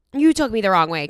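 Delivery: loud retold comeback; mock indignant mom. Daughter imitating mom in a spat, loudly indignant. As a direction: fire it back like a defensive mother.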